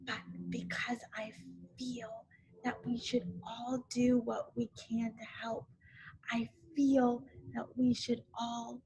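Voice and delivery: hissed stage whisper; loud whisper. A narrator's reading. Eeyore whispering a hint to Christopher Robin, loud whisper. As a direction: whisper it loud so all can hear. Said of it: "said in a loud whisper".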